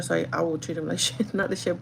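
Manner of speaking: ordinary talk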